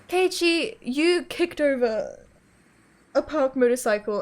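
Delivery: high, slow, incredulous telling-off; exasperated scolding. Real, not acted. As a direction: spell it out in real disbelief. Reader berates the character's stupid act.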